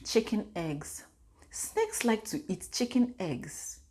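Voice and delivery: put-on soft, slow little-girl voice; quietly imitating a small child; toddler's quote. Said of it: "this girl out of the blue said".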